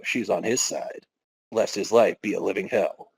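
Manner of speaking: usual speaking voice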